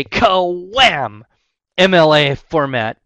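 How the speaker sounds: punchy loud ka-wham; sound effect shout. Pretend. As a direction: slam the word out loud. Sudden burden of documentation.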